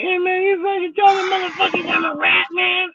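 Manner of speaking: very high mock-indignant squeal; imitated accuser. Retold angry man confronting him.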